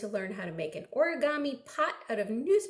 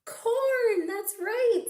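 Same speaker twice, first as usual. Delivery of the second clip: much higher, louder praise; excited reveal; 11 semitones higher, 4 dB louder, slower than usual. Acted. Praising children's guess, excited. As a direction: cheer the right answer.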